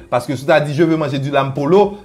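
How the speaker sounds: ordinary talk, nothing marked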